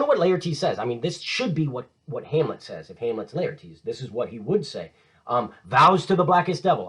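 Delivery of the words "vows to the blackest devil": defiant vow. Acted.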